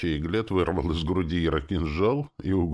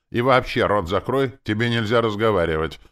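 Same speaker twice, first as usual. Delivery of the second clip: loud, raised scolding voice; stern; 5 semitones higher, 6 dB louder than usual. A narrator's reading. Father forbids the wounded man to talk, sternly. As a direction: order him sharply to be silent. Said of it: "заговорил он строго".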